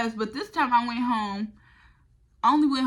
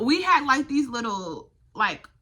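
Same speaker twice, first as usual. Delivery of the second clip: ordinary talk, nothing marked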